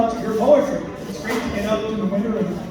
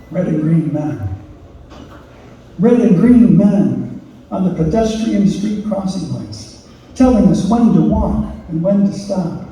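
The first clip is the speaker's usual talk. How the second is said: title announced, then emphatic opening lines; measured, declaimed poem with clear pauses; poetry reading; 5 dB louder than usual. Acted. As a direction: recite with strong phrase starts and falling ends. Reads own poem aloud.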